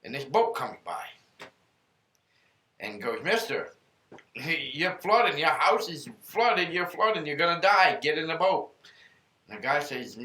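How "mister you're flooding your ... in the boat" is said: urgent rescuer. Retold or imitated. Boat rescuer pleading urgently.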